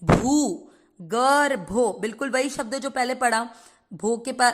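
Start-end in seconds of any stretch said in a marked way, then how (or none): 0.0-1.9 भू drawn out long before गर्भो. Shown as a demonstration. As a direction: stretch the भू, then गर्भो. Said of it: "लंबा खींच देंगे".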